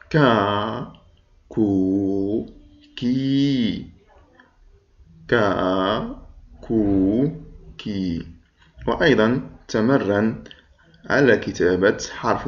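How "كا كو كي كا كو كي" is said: كا, كو, كي each held long; long vowels. Shown as a demonstration. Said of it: "أعد معي حرف الكاف مع الحركات الطويلة". (مد الكاف بالحركات الطويلة)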